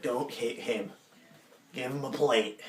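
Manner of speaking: rough smoker's voice, giving orders; mock chain-smoker in charge; heavy smoker woman. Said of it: "She sounds like she smokes, like, four packs a day".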